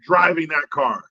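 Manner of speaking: ordinary delivery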